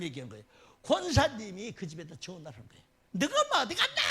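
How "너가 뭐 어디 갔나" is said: high, sharp questioning as the scolding deaconess; 따지는 권사님 흉내. Retold or imitated. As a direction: demand it like an old church lady on the phone. 권사님이 전화로 따지는 장면. (전화로 따지는 권사님 흉내)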